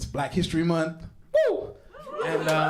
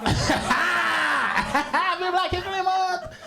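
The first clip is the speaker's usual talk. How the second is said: slow, high, loud shout; shouted greeting; 12 semitones higher, 3 dB louder, much slower than usual. Real, not acted. To the crowd, a slow high shouted greeting.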